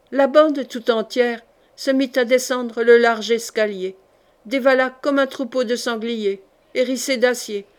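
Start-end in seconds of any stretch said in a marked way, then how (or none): none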